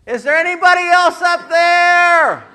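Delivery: high, loud, slow cry upward; desperate plea. Retold or imitated. Man on the branch begging someone else to answer, high and loud.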